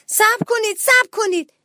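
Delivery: very high, loud cry; panicked plea. Acted. Little mouse pushed toward the pool, crying out in panic. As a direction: beg urgently to stop.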